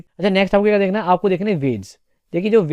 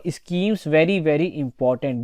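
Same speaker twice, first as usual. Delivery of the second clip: very slow emphasis; much slower than usual. Shown as a demonstration. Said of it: "teacher stresses key topic".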